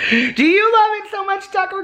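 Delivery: very high cooing question; baby talk. Acted. Owner asking Tucker if he loves it, in high baby talk.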